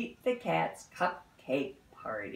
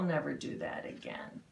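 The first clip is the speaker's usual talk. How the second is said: whispered aside; 7 semitones lower, 5 dB quieter, faster than usual. Real, not acted.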